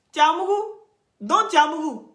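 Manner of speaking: high, loud mocking shriek; mock shock. Real, not acted. Taunting Yeri live, a high, loud mock-shocked shriek. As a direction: squeal it in fake disbelief.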